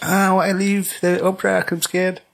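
put-on whiny young Bruce. Mocking young Bruce wanting to leave the opera, whiny and high. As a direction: whine it like a scared rich kid. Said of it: "Quite whiny".

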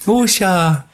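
high, drawn-out fake American "oh"s; mock American accent. Host parodying what the American compadres say, mock American accent. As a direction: ham up a clueless American voice. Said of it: "I'm American".

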